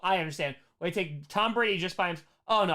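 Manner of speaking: usual speaking voice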